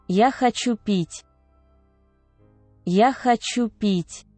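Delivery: usual speaking voice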